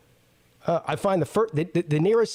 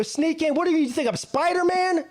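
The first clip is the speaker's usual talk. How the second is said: high, incredulous mock outrage; exasperated, high-pitched retold rant; outraged retort; 9 semitones higher than usual. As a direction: act out his disbelief at the guard. Retold argument, outraged at guard.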